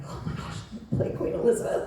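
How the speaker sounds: hushed, rushed excited gush through a laugh; mock breathless fangirl excitement; retold excitement. Actress retelling news of booking Queen Elizabeth, gushing breathlessly.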